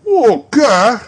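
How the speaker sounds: put-on high, loud boy's voice; a surprised urchin; startled young lad; street boy. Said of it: "exclaimed the boy".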